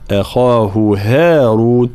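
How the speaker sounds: slow recitation, أخاه with thin a, then thick خا; light hamza, heavy kha. Shown as a demonstration. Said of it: "لابد من ترقيق الهمزة، تفخيم الخاء".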